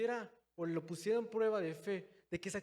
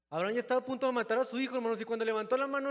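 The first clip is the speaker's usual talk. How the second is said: louder, fast telling of the climax; rising suspense; 4 semitones higher, 6 dB louder, faster than usual. A narrator's reading. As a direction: rush toward the knife moment. Climax of the sacrifice story.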